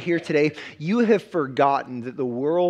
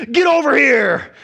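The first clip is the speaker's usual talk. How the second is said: a shouted game fighter's battle cry, much higher and louder; Mortal Kombat shout; 8 semitones higher, 9 dB louder than usual. Retold or imitated. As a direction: yank them over with a fighter's war cry. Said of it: "he would say these words".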